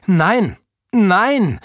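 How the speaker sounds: loud, high cries of protest; horrified refusal. A narrator's reading. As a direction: fend it off in rising alarm. Oda vehemently refuses the slime. (entsetzt und laut abwehren)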